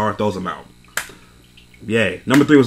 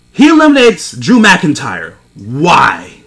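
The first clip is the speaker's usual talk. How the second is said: outraged disbelief; 3 semitones higher, 6 dB louder, much slower than usual. Real, not acted. Outraged at elimination.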